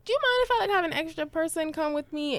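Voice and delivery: high, overly polite asking voice; sheepish polite request. Retold or imitated. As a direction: ask the favour up high and apologetic. Sheepishly asks driver a favour.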